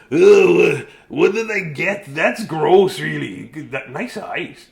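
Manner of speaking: put-on a kid recoiling at an ugly fish; disgusted kid. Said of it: "Look at this kid, eh?".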